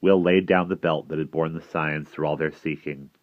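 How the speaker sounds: ordinary delivery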